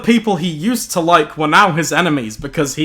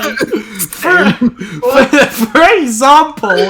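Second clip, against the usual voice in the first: laughing; 6 semitones higher, 4 dB louder, much slower than usual. Real, not acted. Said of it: "I've gotta read that again".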